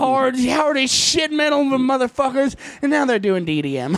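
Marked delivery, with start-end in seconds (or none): 0.4-2.6 mock tough yell. Mocking kids who think they're metalheads.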